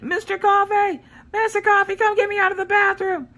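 high, carrying call for help. Retold or imitated. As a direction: call him from the stuck bathroom. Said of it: "knocking on the wall".

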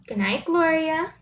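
ordinary talk, nothing marked

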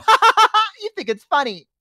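high, loud mocking "ha, ha, ha"; taunting kid laugh. Kids taunting the babysitter, mocking laugh. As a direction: tease like a cheeky child. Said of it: "and they go".

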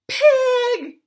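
very high, loud shriek of pig; a startled kid spotting a pig; shouted. A narrator's reading. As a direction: yell it out in alarm. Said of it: "shouted Mouse".

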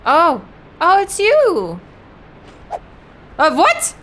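very high, loud panicked squeal; panicked shriek. Real, not acted. Sudden enemy appears.

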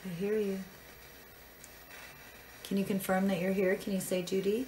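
normal talking voice

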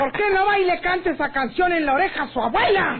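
grito furioso, mucho más agudo y fuerte; enojada. Acted. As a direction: lo regaña a gritos, muy lenta al empezar. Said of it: "No se enoje". (Zenaida le grita furiosa al pretendiente atrevido)

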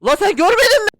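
very high loud shriek. Real, not acted. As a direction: shriek it at him. Shrieking at character. (yüksek tiz çığlıkla)

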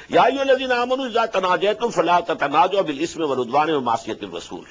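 measured chanted Arabic recitation; Quran recitation. Acted. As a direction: intone the verse as in tilawa.

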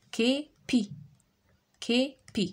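Kepi split into two beats, ké, pi; syllable by syllable. Shown as a demonstration. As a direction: leave a gap inside Kepi. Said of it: "Frappe les syllabes dans tes mains".

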